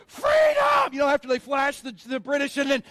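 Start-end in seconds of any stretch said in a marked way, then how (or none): 0.1-0.9 much higher, loud rallying scream; battle cry yell. Retold or imitated. Braveheart soldiers charging, battle cry yell. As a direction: roar it like a charging army. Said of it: "everybody's yelling".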